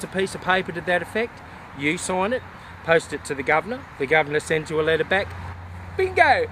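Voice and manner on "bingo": triumphant bingo. Real, not acted. Triumphant punchline of explanation.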